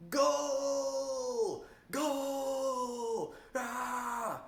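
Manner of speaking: roaring stadium commentator goal cry; soccer announcer. Retold or imitated. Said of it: "If you've heard soccer announcers".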